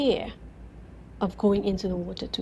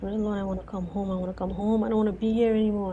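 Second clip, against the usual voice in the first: ordinary talk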